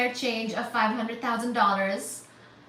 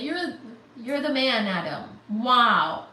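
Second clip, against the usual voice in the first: normal talking voice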